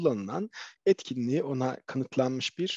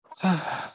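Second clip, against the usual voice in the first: audible sigh; 6 semitones higher than usual. Shown as a demonstration. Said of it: "şöyle bir ah demek gayet olası". (sesli iç çekiş)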